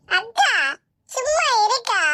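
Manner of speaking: high, tearful pleading through sobs; crying plea. Acted. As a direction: beg her sister to stop, voice breaking with tears. Younger sister pleads while crying. (அழுதுகொண்டே கெஞ்சும் குரல்)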